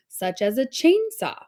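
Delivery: normal talking voice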